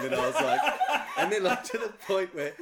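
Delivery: high, loud, tickled by the memory; laughing retelling. Real, not acted. Guest telling hosts how he doubted the surprise trip, laughing. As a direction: tell it loudly through real laughter. Laughing at his own emotional reaction.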